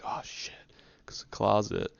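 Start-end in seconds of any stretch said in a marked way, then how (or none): 0.0-0.5 breathless whispered curse; whispered dread. Retold or imitated. Teen as dad opens the closet, a whispered curse. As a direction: whisper it, caught out. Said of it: "I'm like".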